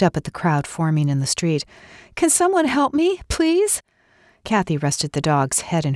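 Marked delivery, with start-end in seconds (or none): 2.1-3.8 much higher urgent plea; calling for help. Acted. Kathy begging onlookers for help, high urgent call. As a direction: call out to the crowd.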